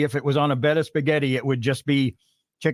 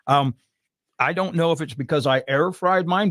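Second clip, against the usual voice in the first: ordinary talk, nothing marked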